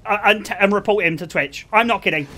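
ordinary talk

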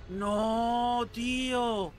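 high, loud cry of dismay; a high, loud shout of real frustration. Real, not acted. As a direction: yell it in disbelief at the screen. Dismay at enemy Nito again.

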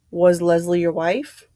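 WIFE lifted in a rising question. A narrator's reading. Vera asks the general about Leslie, questioning, WIFE rising. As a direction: ask it, letting "wife" rise at the end. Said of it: "Vera said questioningly".

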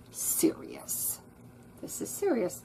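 breathy hushed word; whisper. A narrator's reading. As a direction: whisper it gravely.

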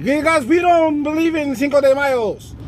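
put-on animated Mexican-accented rebuttal. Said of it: "Mexican's like".

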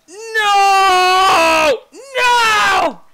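long, high screamed cry; dramatic wail. Pretend. Player reacting to cultivation failure, dramatic wail. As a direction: scream it out, stretched and very loud. Operation has failed.

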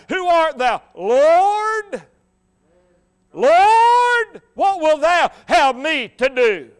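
high, loud cry, "Lord" held long. Acted. Saul of Tarshish cries to the Lord, crying out. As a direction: call out with full voice. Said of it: "cried out".

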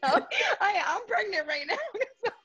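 high, teary laugh running through the words; half laughing, half crying, slowed down; laughing through tears. Real, not acted. Guest explains her tears to the host, laughing and crying.

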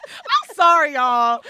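loud, high apology through real laughter; laughing apology. Real, not acted. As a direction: apologize while cracking up. Breaks up laughing, apologizes to listeners.

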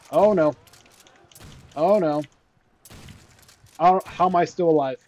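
loud, high alarmed cries; real panic. Real, not acted. Gamer amazed to be still alive, panicked. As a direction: yell it as danger closes in. Near death in the zombie game.

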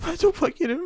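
high fake sobbing; mock crying. Poor Spider-Man fake-crying to the waiter. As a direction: whimper it like a fake cry. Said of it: "Ağlamayın efendim".

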